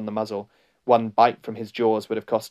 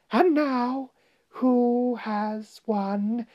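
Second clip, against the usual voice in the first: high, drawn-out gloating witch; witch's high tremulous taunt; quivering voice; 12 semitones higher, much slower than usual. A narrator's reading. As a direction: draw it out slowly in quivering triumph. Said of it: "said in a quivering voice".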